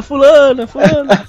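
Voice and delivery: calling out a name, high; yelling her name up at the window; mock yelling a name. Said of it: "você ficou gritando".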